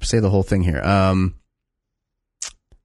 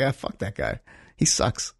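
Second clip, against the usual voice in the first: dismissive mutter; 3 semitones lower, 8 dB quieter, slower than usual. Retold or imitated. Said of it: "And I was like".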